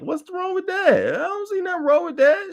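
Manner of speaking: faux-naive falsetto, pretending not to see any problem; mock innocent. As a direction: play dumb in a falsetto, drawing it out. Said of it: "sat here in front of y'all like".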